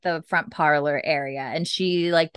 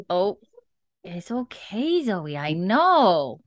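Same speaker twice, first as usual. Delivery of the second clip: slow, singsong comforting; soothing pet talk; slower than usual. Real, not acted. Host calms Zoe after an interruption, soothing pet talk. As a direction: draw it out to settle an upset pet.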